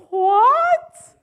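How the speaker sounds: high, loud, drawn-out stunned what; a frozen disbelieving what, way up high; slow-motion shocked what. Pretend. Miming the hang-up shock for the audience, loud stunned what. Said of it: "in slow motion or like".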